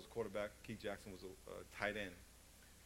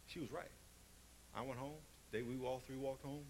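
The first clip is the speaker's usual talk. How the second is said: ordinary talk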